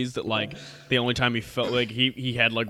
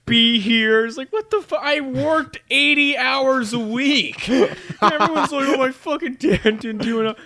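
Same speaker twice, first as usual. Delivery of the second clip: higher, indignant dad voice; pitched-up outraged dad; exasperated dad rant; 9 semitones higher, 4 dB louder than usual. Pretend. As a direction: rant as an offended father who gave everything.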